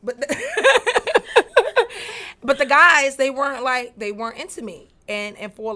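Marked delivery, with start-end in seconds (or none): 0.4-2.4 burst of laughter. Real, not acted. Laughs at own story.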